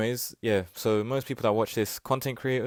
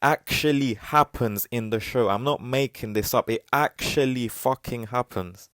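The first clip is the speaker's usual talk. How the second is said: slow, deliberate delivery so every word lands; slower than usual. Shown as a demonstration. Slowing down so viewers believe it, slow and deliberate. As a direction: spell it out slowly for viewers. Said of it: "Let me slow down with the quick voice".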